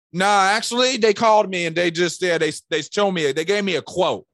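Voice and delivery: high, loud client voice; explaining client. Acted. Client admitting to the salesman it was a quote, high and loud.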